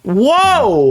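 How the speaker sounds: a high, loud, drawn-out whoa; stunned whoa. Retold or imitated. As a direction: react as if hit by something shocking. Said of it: "And I'm like".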